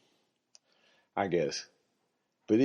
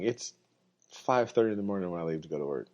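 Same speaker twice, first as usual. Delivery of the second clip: ordinary talk, nothing marked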